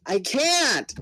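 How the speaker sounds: a fighter who cannot help the others cries out; panicked cry. Acted. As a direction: plead it helplessly.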